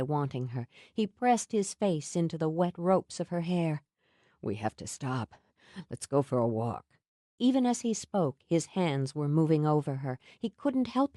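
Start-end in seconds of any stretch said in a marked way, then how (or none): 4.4-7.0 lower, softer voice holding back; low restrained Sam. A narrator's reading. Sam resisting desire.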